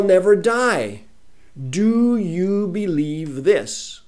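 very slow, loud, stretched words; slow, loud preaching voice drawing out every word; scripture reading. Acted. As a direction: intone it like a solemn proclamation. Rereads the passage in full.

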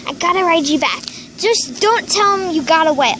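normal talking voice

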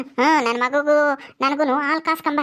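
ordinary talk, nothing marked

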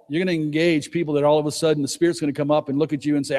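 normal talking voice

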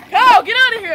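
loud, fed-up brush-off; annoyed shout. Acted. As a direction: shoo him off, irritated. Shooing friend away from game.

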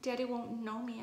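ordinary delivery, nothing marked

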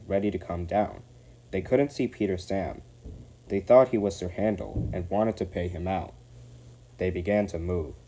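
ordinary delivery

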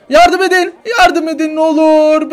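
high, loud desperate plea; frantic begging shout; screaming for help. Acted.

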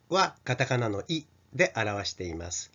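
usual speaking voice

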